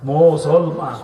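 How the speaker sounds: "মুসলমান" pulled apart into three syllables; "মুসলমান" syllable by syllable, as mus-sol-man; split into syllables. Shown as a demonstration. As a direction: demonstrate the three parts of "মুসলমান". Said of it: "তিন ভাগে ভাগ করা যায়".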